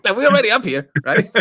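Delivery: high, fast, pleading to the pilot; frantic pleading. Retold or imitated. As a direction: beg the pilot to land, rushed and high. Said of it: "I'm like".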